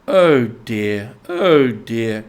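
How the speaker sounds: a touch of exasperated sighing; mild despair. A narrator's reading. Rat despairing at the mole, mild sigh. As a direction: lament his friend's slowness lightly. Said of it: "in despair".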